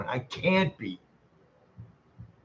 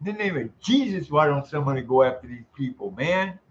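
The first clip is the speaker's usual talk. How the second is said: ordinary talk, nothing marked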